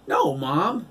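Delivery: loud, high cry of protest; a boy calling out to his mom. A narrator's reading. Boy cries out to his mother in the church, loud and high. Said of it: "Sandy cried".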